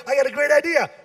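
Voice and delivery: high, excited pitcher; hammed-up eager entrepreneur. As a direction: pitch it with mock enthusiasm. Imagined business pitch on Shark Tank.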